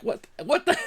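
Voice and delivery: high, baffled what the; squeaky retold disbelief; stunned disbelief. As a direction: sputter in shock. Cashier ignoring him.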